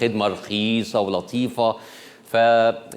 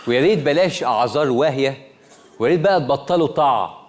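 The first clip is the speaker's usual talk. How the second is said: raised, higher scolding plea; mock-stern appeal to internet providers; 6 semitones higher than usual. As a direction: scold the companies with mock indignation. Host scolds internet companies.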